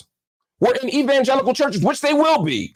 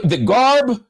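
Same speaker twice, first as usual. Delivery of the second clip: usual speaking voice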